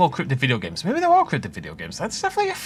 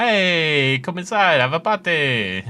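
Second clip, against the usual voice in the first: loud, higher, badly faked Italian accent; 6 semitones higher, 5 dB louder, much slower than usual. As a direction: greet like a hammed-up Italian host. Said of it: "They're Italian. Not very effectively".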